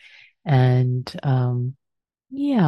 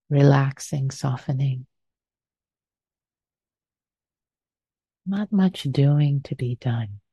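guided meditation. Acted. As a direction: ease them gently into stillness.

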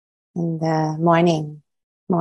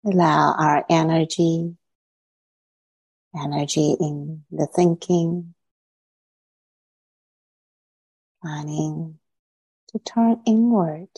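guided meditation. Acted. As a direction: ease listeners inward, unhurried.

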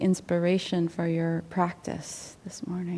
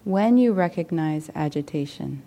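measured teaching read aloud. Acted. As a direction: read it as a contemplation, phrase by phrase. Reads a meditation teaching aloud.

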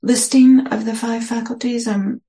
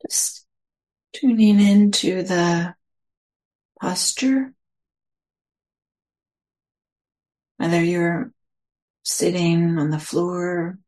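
guided meditation. Acted. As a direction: ease listeners into their posture, unhurried.

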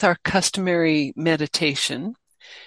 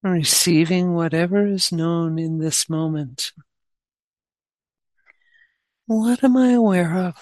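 guided meditation. Real, not acted. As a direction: guide them gently, leaving long pauses between phrases.

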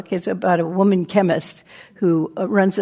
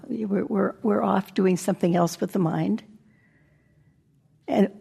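usual speaking voice

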